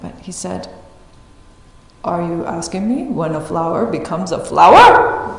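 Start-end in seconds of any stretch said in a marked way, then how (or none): 2.0-5.4 louder, mock-stern monk question; Shodo's question. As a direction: ask it like a deadpan Zen teacher. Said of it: "I can't do the German accent".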